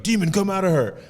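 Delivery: lightly re-enacted casting out of a demon; exorcist's command. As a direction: command the demon out, without shouting. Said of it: "be like".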